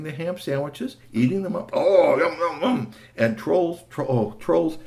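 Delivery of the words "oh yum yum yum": loud, higher troll smacking its lips; greedy troll yum. Acted. Troll gobbling the ham sandwiches, loud and greedy. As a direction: relish the food like a greedy troll.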